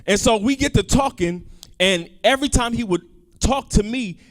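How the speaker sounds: ordinary talk, nothing marked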